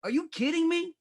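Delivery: high, incredulous what, are you kidding; mock disbelief. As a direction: play up the outrage of a shocked buyer. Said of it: "I'm like".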